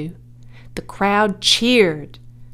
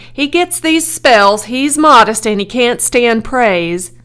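loud, raised-pitch explaining; crying out; 4 semitones higher, 8 dB louder than usual. A narrator's reading. Owner excuses his fainted pig, loud urgent cry. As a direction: call the excuse out to the crowd. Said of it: "cried Mister Zuckerman".

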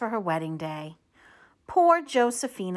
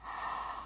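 gasp; 14 dB quieter than usual. Pretend. Reveals the beautiful dress.

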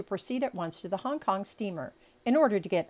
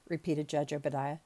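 ordinary delivery, nothing marked

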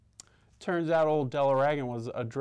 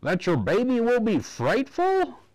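high, louder, pressing doctor; worried doctor; 12 semitones higher, 6 dB louder than usual. A narrator's reading. Doctor pressing Della, high and worried. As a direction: push the worry on her. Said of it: "he carried on to say".

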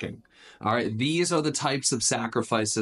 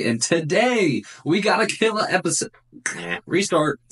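podcast host; 5 dB louder than usual. Acted. Podcast intro as Coach Chad.

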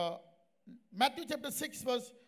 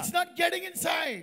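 raised, shouted preaching; forceful insistence; 4 semitones higher, 4 dB louder than usual. Retold or imitated. Preacher telling a sick woman why healing is blocked, forceful.

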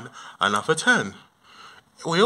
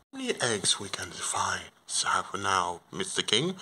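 low, soft bear voice, halting; humble Paddington; 7 semitones lower, 10 dB quieter than usual. Acted. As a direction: speak low and hushed, like a bashful bear. Tired bear thanks the king.

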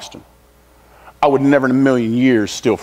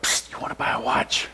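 secretive raspy sales murmur; 11 dB quieter than usual. Retold or imitated. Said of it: "you know you got the guy".

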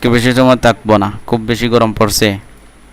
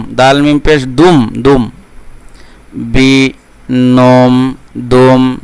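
slow, measured syllable-by-syllable recitation; Quran spelling drill; 2 semitones higher, much slower than usual. Shown as a demonstration. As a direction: draw out each syllable for learners to repeat. Teacher models spelling drill.

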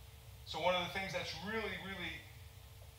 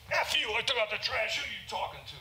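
angry retort; 6 semitones higher than usual. Retold or imitated. Husband curses back at wife.

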